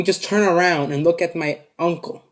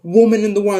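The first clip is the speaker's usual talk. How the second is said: louder, higher acted insult; raised-voice retold tirade; cursing gunman; 5 semitones higher, 5 dB louder than usual. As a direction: hurl the insult as the angry robber. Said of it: "starts cursing at my grandma".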